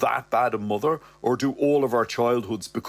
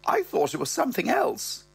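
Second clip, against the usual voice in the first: high, posh-sounding mother voice pitched way up; mother's voice. Acted. Narrator voicing his mother, high-pitched. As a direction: voice the old dear up high. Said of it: "She goes".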